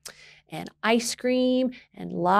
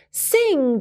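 put-on girl reading a word; 7 semitones higher, 4 dB louder than usual. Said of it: "the girl read".

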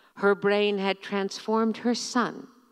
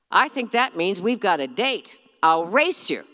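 loud, playful shout to mother; a cheeky brother shouting a challenge; 7 dB louder than usual. A narrator's reading. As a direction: call it out over the engine. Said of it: "Sam shouted".

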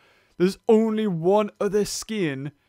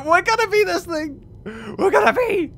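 excited; 12 semitones higher, 5 dB louder than usual. Real, not acted. Excited announcement.

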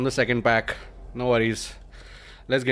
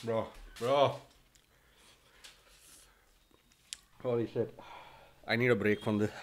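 pained quiet; 2 semitones lower, 11 dB quieter than usual. Real, not acted. Chili burning his mouth.

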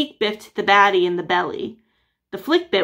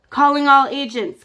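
loud official radio call-out; police dispatcher blaring over the radio; radio announcer; 5 semitones higher, 5 dB louder than usual. Acted. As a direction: broadcast it like a dispatcher. FBI radio call comes in.

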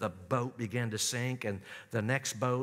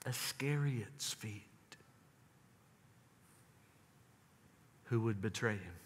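hushed whisper; 5 dB quieter than usual. Acted. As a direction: drop to a grave hush. Whispered name of the betrayer.

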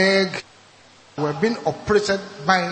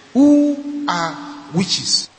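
slow, drawn-out question; 6 dB louder, much slower than usual. Retold or imitated. Daughters' slow ominous question.

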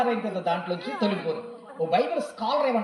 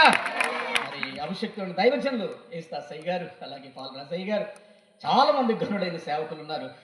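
ordinary talk, nothing marked

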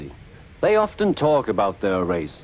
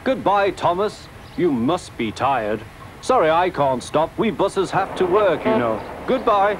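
higher-pitched, breezy taunt; teasing farewell; 7 semitones higher than usual. A narrator's reading. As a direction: toss goodbye to the rival you pass. Bertie taunts Thomas while leaving.

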